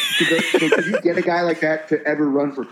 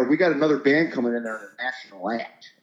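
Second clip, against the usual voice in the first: Southern bar owner; 3 semitones higher than usual. Retold or imitated.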